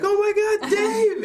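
high, loud acted alarm; alarmed cry. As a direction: cry out in shock at finding him. GM voices woman finding Dave.

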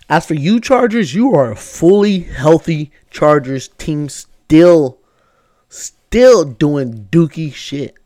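loud, slow, drawn-out scolding; scolding shout. Real, not acted. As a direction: call them out hard. Scolding the Chargers.

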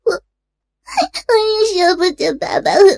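high, sobbing, stretched-out wail; tearful plea. Acted. Daughter sobbing to her parents about their divorce, high and crying. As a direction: cry it out, stammering through tears. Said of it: "小玲的哭诉".

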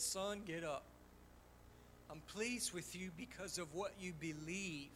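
soft, low voice of God comforting him; a still, gentle whisper-like reassurance; small voice. Retold or imitated. God speaking softly to the crying pastor, tender and quiet. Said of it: "small voice says".

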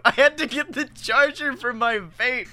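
normal talking voice

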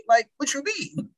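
high-pitched, baffled question; incredulous comeback. Acted. Answering critics of who he dates, incredulous. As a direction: throw it back in mock disbelief.

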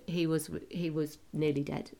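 ordinary talk

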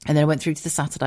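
ordinary delivery

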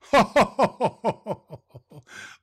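big high jolly laugh; Santa's belly laugh. Real, not acted.

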